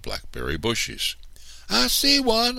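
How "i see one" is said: high, louder eager shout; a girl spotting blackberries, shouting; excited shout. A narrator's reading. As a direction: shout out the find with glee. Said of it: "I shouted".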